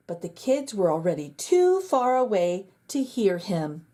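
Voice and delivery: usual speaking voice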